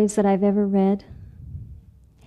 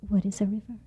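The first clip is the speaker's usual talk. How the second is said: much softer small asking; timid question; 9 dB quieter, faster than usual. Acted. Little mouse asking Raccoon, soft and shy.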